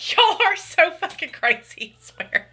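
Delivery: high, giggly and fond; laughing fondly. Real, not acted. Streamer telling her chat they're crazy, still laughing.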